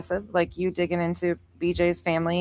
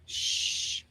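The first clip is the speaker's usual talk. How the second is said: shushing; 10 dB quieter than usual. Real, not acted. Shushing, a remote location.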